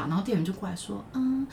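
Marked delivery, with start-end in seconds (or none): 1.1-1.5 scared waiter. Retold or imitated. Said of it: "店员就超害怕".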